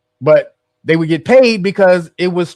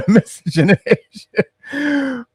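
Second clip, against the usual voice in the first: laughing; 8 semitones higher, much slower than usual. Real, not acted. Laughing at failing to say it.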